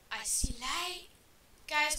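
usual speaking voice

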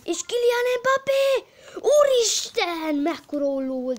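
high, loud, laughing goal cry; excited goal call. Acted. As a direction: scream the goal. Goal call then laughing awe.

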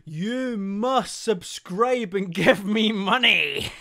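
slow, loud, hammed-up greedy voice; laughing mock greed; mock greedy demand. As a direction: drag it out like a greedy villain.

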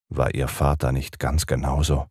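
usual speaking voice

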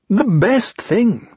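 high, very slow bear voice; Pooh's insistence. Acted. Pooh defending honey to the narrator, high slow insistence. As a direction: draw it out high and certain.

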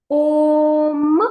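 «Ом» held long and loud; drawn-out syllable. Shown as a demonstration. Teacher reading syllables aloud with the boy. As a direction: sing out «Ом» slowly. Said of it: "читай разом зі мною".

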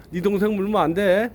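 ordinary delivery

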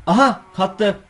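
excited exclamation. Real, not acted. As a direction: cheer it out. Animal finally joins.

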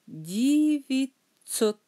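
Девятьсот stretched out, di-vit-SOT; slow syllables. Shown as a demonstration. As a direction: draw Девятьсот out slowly, part by part.

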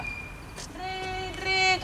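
high ring swelling from soft; imitated phone ring. Phone going off in a pocket, voiced soft-to-strong ringing. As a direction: mimic a ringing phone, faint then growing.

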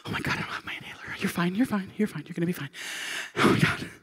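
breathless mock-panicked whisper; frantic self-soothing under the breath. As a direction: act out a hushed panic attack. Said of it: "and then the panic".

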